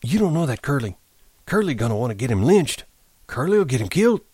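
put-on high, agitated old man; urgent, high-pitched warning with swooping tune; excited. A narrator's reading. Candy warns George about Curly, excitedly. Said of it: "But Candy said excitedly".

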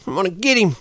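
high, fast, furious threats; Curly rallying the men, high, fast and furious; fury. A narrator's reading. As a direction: whip the men up into a lynching rage. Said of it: "worked himself into a fury".

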